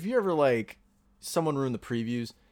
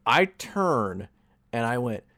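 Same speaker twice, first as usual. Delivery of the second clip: normal talking voice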